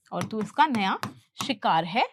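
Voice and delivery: normal talking voice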